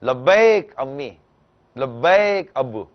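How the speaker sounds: loud and slow, each word drawn out; slow ringing reply. Shown as a demonstration. Preacher teaching children the answer to parents, slow and loud. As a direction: proclaim it so they can learn it. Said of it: "آپ ایک جملہ سیکھیں".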